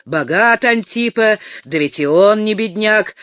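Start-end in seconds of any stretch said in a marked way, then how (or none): none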